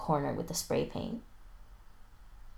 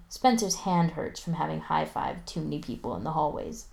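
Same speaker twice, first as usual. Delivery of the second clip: ordinary delivery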